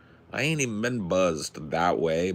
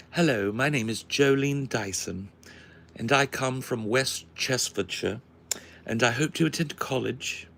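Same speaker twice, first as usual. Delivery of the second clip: put-on upper-class British, pitched higher; high British accent; 4 semitones higher than usual. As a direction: introduce yourself in mock high British. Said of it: "a high British accent".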